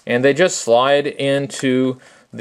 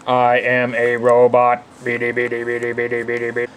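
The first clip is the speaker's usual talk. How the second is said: robot voice; 4 semitones lower than usual. Retold or imitated. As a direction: chant it like a clunky robot. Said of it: "reminds me a lot of the robot in that movie".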